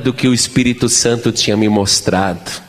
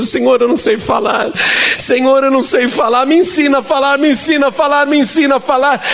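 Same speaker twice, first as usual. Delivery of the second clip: much higher, pleading, fearful cry to God; desperate prayer; 12 semitones higher, faster than usual. Retold or imitated. Young preacher pleading with God in fear, high desperate plea. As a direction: beg like you're terrified you can't speak.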